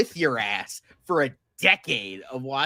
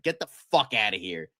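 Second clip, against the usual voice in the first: ordinary talk, nothing marked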